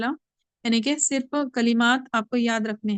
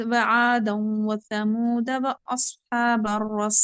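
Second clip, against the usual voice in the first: slow, drawn-out tajweed recitation; Quran recitation; much slower than usual. Shown as a demonstration. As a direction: chant the verse slowly with care. Teacher recites Quran verse. (धीमी तजवीद वाली तिलावत)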